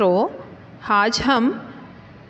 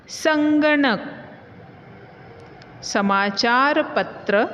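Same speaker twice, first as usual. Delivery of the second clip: word list recital. Shown as a demonstration. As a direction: read each word out for the class to repeat. Teacher reads word list aloud.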